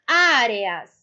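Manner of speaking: "Áreas" with a long, strong opening Á; stressed syllable shown. Shown as a demonstration. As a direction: punch the Á at the start of "Áreas". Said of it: "ela é penúltima".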